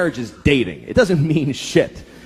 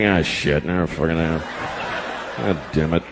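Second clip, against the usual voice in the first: grumbling guy; 11 semitones lower, 5 dB quieter than usual. Retold or imitated. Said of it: "The guys are like".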